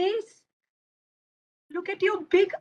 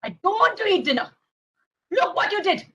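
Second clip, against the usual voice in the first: high, whining tantrum voice; acted childish outrage; angry child; 8 semitones higher than usual. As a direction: wail in protest like an upset little boy. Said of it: "I'm getting so angry".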